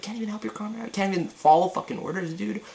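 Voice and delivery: high, strained exasperation; exasperated rant. Real, not acted. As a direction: vent in a high disbelieving voice. Mocking Urie's failures.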